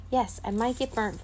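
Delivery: usual speaking voice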